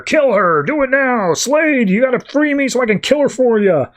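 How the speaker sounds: climbing frenzied cry to Slade; frantic yell. Retold or imitated. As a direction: yell it like a man losing control. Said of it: "Bronze Tiger just yells out".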